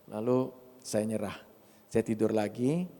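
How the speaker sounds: usual speaking voice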